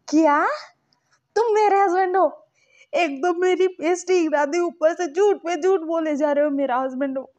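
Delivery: high, loud name-calling tantrum; angry. Retold or imitated. Lanson insulting the man claiming to be husband, loud tantrum. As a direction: hurl insults like a furious child. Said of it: "ऐसा गुस्से में बोल रहा था".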